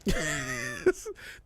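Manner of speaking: high, soft laugh at himself; rueful giggle after ranting; exasperated laugh. Real, not acted. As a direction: laugh off the rage. Laughing at own anger.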